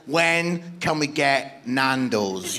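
low, slow deadpan thought; slow, low, blank delivery; son's thought bubble. Retold or imitated. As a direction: play an unmoved, hungry son. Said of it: "a little thought bubble above his head that says".

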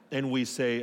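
ordinary talk

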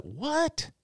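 very high startled what; mock shocked what. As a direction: caught totally off guard. His own shocked reaction.

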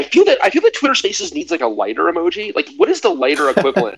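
high, animated joking; excited. Real, not acted. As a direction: pitch up, eager and playful. Excited joke about lighter emoji.

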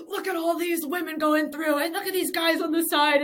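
high, loud mock commentator yell; commentator shouting. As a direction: belt it out like an excited sports commentator. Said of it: "the commentator is literally like".

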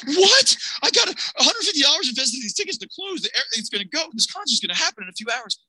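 high-pitched panicked outburst; freaking out. Retold or imitated. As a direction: protest in disbelief, pitch leaping way up. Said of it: "I'm freaking out".

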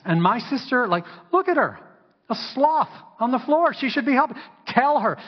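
high, loud, sassy complaining; sassy complaint. Acted. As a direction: scold Jesus with indignant sass. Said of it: "with a little sass".

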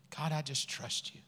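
soft, low surrender; quiet prayer. Acted. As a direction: murmur it trustingly to God. Models a prayer of trust.

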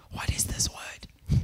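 slow secretive whisper; conspiratorial whisper to the mum; whispered question. Retold or imitated. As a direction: whisper it so the child can't hear. Asking the mother in secret.

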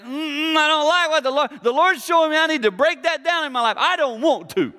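high, whining mock complaint; whiny resistant listener. Preacher mocking a listener who won't change, high and whiny. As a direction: whine it like a sulky child.

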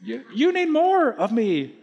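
very high, put-on talking-wallet voice; comic high-pitched voice of fretful money. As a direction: squeak like a nagging, worrying wallet. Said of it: "do the impression of money".

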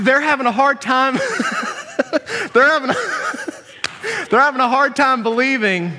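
cracking up, high-pitched through laughter; real laughing fit. Real, not acted. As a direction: try to talk while overcome with laughter. Cracks up trying to continue.

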